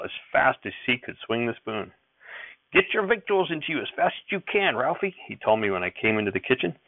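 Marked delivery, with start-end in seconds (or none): 2.7-5.1 put-on high, hurried old farmer; grandfather's voice. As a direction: rush the boy along, old man.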